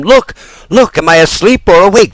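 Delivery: high, loud, wild cries of recognition; yelling wildly. Acted. Harlequin shouting across the theater to Pinocchio, wild yell. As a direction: shout it out like you can't believe your eyes. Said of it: "yelling wildly".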